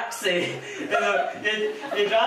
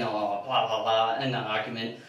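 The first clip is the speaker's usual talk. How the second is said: mocking arguer; 8 semitones lower than usual. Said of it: "heard people saying".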